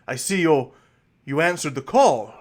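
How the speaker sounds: eager noble host. Acted.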